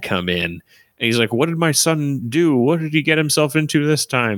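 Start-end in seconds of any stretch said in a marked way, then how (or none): 1.3-4.4 high Texan twang, dad worried; Texan dad asking what his son did; Hank Hill voice. Retold or imitated. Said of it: "I like how he sounds like Ki-, King of the Hill".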